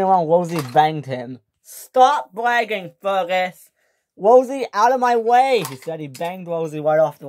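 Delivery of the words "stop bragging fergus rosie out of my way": higher, louder angry yell; angry shout. Acted. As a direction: snap at them to move. Engines quarrel on the tracks.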